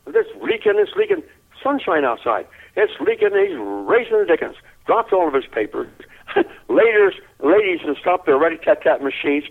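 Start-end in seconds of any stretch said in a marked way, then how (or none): 0.1-3.3 high-pitched mock mumbling; imitated grumbling. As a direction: lightly act out a grouch's indignant mumble.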